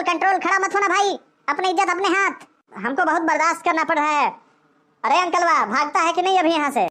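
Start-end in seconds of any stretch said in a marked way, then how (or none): none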